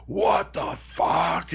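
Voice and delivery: drawn-out mock bellow at half volume; stretched-out, hammed-up outrage; booming voice. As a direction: imitate a friend's booming outburst, very slow. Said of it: "really deep booming fucking voice".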